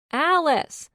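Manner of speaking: high, loud call of the name; shouted name. Acted. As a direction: yell her name into the phone. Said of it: "Nikki shouted".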